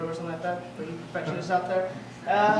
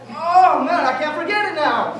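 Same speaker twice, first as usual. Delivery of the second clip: high, loud, exasperated; frustrated loud whine; 8 semitones higher, 11 dB louder, slower than usual. Retold or imitated. Boyfriend to girlfriend who said forget it, loud frustrated whine. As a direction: plead with her to just say it.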